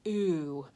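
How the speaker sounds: ooh drawn out slow and round; long ooh sound. Shown as a demonstration. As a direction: model the vowel ooh slowly for kids. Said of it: "you and I says ooh".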